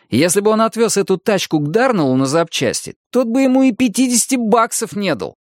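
high-pitched, insistent protest; exasperated arguing. A narrator's reading. Dennis telling Arnie he is being mocked, exasperated. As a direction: press the point hard, pitched well up. Friend argues against buying the car.

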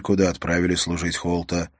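usual speaking voice

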